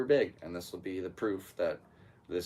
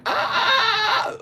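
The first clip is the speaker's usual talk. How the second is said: sudden high yelping scream, much higher and louder; mock scream of shock; 10 dB louder than usual. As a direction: let out a hammed-up pained shriek. Guy in vacuum clip screams.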